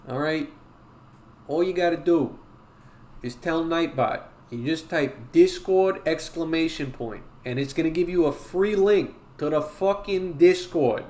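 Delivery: emphatic Brooklyn Italian wise-guy talk; Brooklyn Italian accent. Acted. Streamer telling chat about the Discord, put-on Brooklyn Italian accent. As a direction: lay down the rules in Brooklyn Italian. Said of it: "can you do a Brooklyn Italian accent?".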